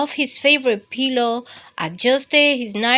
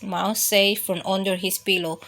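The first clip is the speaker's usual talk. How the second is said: ordinary delivery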